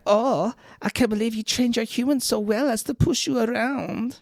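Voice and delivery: put-on high-pitched French accent. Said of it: "That's a good accent".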